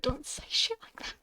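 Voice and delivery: whispered telling off; hushed scolding. Acted. Phoenix hushing Godot in public. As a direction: hiss it so nobody hears.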